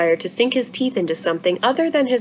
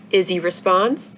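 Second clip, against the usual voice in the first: ordinary delivery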